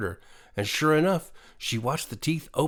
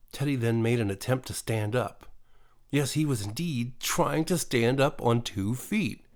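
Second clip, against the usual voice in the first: usual speaking voice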